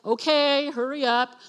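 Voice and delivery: mock impatience, urging the doctor on; impatient urging. Impatient patient awaiting scan result.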